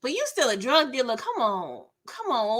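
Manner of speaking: usual speaking voice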